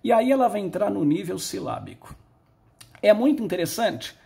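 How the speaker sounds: usual speaking voice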